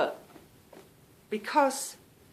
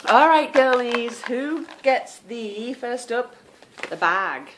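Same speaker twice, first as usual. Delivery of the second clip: loud, drawn-out game host call; prize-draw announcement; 5 semitones higher, 9 dB louder, slower than usual. Real, not acted. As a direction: build suspense for the prize draw.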